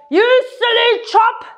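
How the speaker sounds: high yelled insult out a car window; mock shout. Teacher acting out yelling at a driver, high mock shout. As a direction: pretend to yell at the driver. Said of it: "shout out the window".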